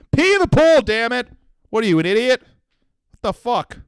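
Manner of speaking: high-pitched mock-angry outburst; exasperated rant. Comic berating listeners who won't pee in pools, exasperated outburst.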